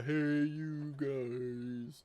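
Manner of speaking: slow, drawn-out feeble call; a bellow that fizzles into a greeting; weak little bellow. Retold or imitated. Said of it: "Kinda comes out like a".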